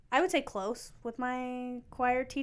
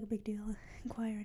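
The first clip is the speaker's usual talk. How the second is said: whispered aside; 2 semitones lower, 12 dB quieter than usual. Real, not acted. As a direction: whisper it, much softer. Aside about choir status.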